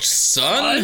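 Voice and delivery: "son" tossed off high and cocky; high mock "son". Brother ending his joke on a cocky high "son". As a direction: land "son" like a cocky punchline.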